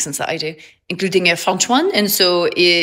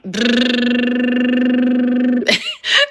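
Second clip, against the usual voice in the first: lip trill buzz; 5 semitones higher than usual. Real, not acted. Laughing at lip trill video.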